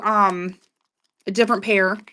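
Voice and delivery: ordinary talk, nothing marked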